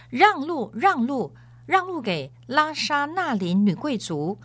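high-pitched crier's call to clear the way; herald calling out. A narrator's reading. Crier clearing the street for a noblewoman's litter, calling out high. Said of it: "另外一个开道人又在放声大叫了".

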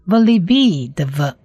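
ordinary talk

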